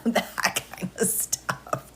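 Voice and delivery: soft, slow unvoiced aside; whispered aside. Real, not acted. Tells chat in a whispered aside. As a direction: drop to a whisper.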